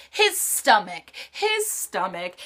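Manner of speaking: slow, drawn-out punchline; his stomach said slowly, word by word; slow dramatic repetition. Acted. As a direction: drag out his stomach for effect.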